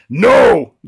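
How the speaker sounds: loud, high mock-mom shout; stern refusal. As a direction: shut the kids down loudly. Elephant mother overruling her children.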